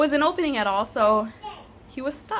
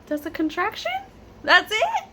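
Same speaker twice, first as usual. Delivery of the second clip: surprised disbelief; 10 semitones higher than usual. Retold or imitated. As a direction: act amazed that a contraction barely registers. Retold disbelief at painless contraction.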